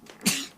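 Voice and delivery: loud sudden sneeze; real sneeze mid-sentence; sneezing. Real, not acted. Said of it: "J'ai éternué".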